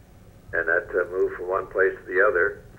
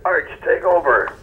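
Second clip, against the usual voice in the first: quoting the boss, pitched higher; a boss handing over the mic, voice raised in pitch; boss's order; 10 semitones higher, 5 dB louder than usual. Retold or imitated. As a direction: toss off the boss's order up high. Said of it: "he said".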